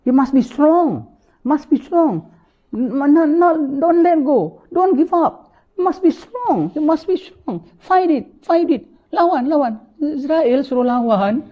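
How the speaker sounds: high, pleading urging to fight on; desperate relative. Acted. Relative at the bedside urging the dying to fight, high and desperate. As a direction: beg them not to give up, pitched high.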